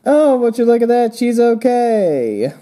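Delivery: high, loud, stretched-out delight; happy relief. Acted. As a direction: exclaim it high, dragging out the ending. Acted relief at finding her safe.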